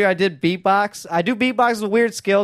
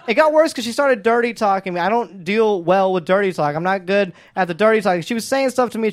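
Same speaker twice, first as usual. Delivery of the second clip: usual speaking voice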